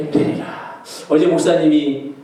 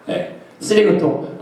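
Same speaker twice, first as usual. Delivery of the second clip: ordinary delivery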